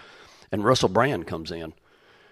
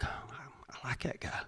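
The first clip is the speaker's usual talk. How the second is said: slow, soft whisper; whispered aside; 3 semitones higher, 17 dB quieter, slower than usual. Retold or imitated. Preacher admits a new liking for a celebrity, slow whisper. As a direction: whisper it like a private thought. Inner thought about Russell Brand.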